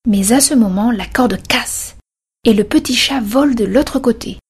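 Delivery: ordinary delivery, nothing marked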